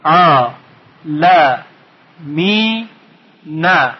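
"ع، ل، می، نه" in four separate beats; syllable by syllable. Shown as a demonstration. Teacher recapping the four parts, syllable by syllable. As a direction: say each part alone, stretching "ع" and "می". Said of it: "این کلمه چهار بخش داره".

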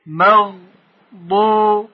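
«مغضوب» very slowly as مغ، ضو، بِ with pauses; syllable by syllable. Shown as a demonstration. Teacher modelling a prayer word, «مغضوب» syllable by syllable.

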